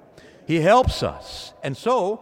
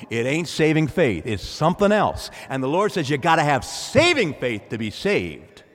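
ordinary delivery

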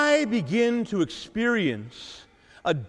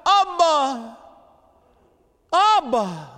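raised, higher cry of Abba; fervent cry of Abba; 7 semitones higher, 3 dB louder, much slower than usual. Acted. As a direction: call out Abba like a child to its father. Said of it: "cries out".

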